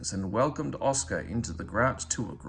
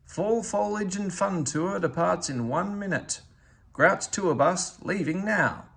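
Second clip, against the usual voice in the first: loudspeaker announcement; 7 semitones higher, 4 dB louder than usual. Acted. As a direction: call it out like a station PA. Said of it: "an announcement came over the loudspeaker".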